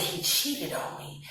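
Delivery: whispering to herself that he cheated; shocked whisper. Retold or imitated. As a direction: breathe it out as the truth sinks in. Retold shocked realization.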